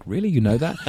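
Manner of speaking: lightly acted disbelief, rising at the end; retold amazed double-check; mild mock surprise. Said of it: "I'm like".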